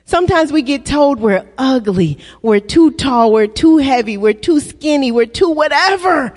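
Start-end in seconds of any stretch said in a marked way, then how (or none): none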